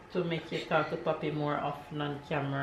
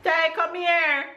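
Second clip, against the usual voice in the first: calling out; 11 semitones higher, 5 dB louder, slower than usual. Real, not acted. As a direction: call him over, slow and high. Mother calling sad child.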